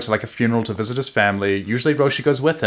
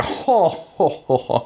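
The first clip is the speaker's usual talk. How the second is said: high, hooting stylised laugh; affected princess laugh; 6 semitones higher, much slower than usual. Shown as a demonstration. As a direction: hoot it out like a haughty rich girl. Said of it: "He's obviously him doing this".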